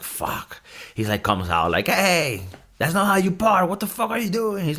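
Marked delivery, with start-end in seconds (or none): 0.0-0.6 unvoiced whispered fuck under the breath; whispered curse. Otto sees bad parking, whispered curse. 1.9-4.6 higher mock-angry old man calling out; a lightly voiced grumpy old man scolding; yelling at a neighbour. Otto scolds the driver parking wrong, mock yell.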